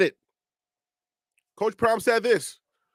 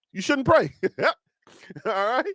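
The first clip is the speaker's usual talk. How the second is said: ordinary talk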